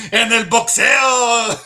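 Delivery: put-on high, slow, laughing Mexican accent on "el boxeo"; hammed-up Mexican announcer accent, laughing; mock Spanish. Joking Spanish follow-up.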